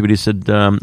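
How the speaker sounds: usual speaking voice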